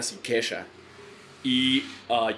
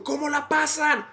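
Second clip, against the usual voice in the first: much higher, slow emcee call-out; hyping the crowd; slower than usual. Retold or imitated. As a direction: stretch it out to a full arena.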